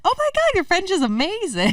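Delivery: mock a fan who is wildly impressed; gushing English speaker.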